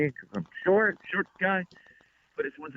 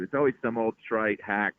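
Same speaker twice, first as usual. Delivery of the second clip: usual speaking voice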